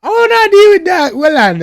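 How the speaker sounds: very high, loud yelp; startled outcry. Real, not acted. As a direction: blurt it out in shock. Startled reaction to lyrics.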